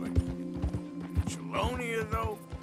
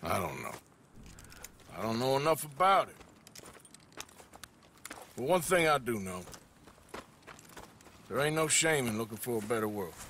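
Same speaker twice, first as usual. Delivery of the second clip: low-pitched, subdued reassurance; gentle consolation; 11 semitones lower, 4 dB quieter, faster than usual. Acted. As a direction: console him quietly, deep in the voice. Consoling boy after standoff.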